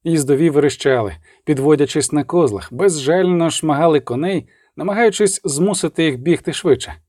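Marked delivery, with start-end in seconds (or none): none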